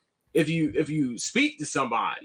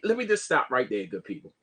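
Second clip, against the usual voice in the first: usual speaking voice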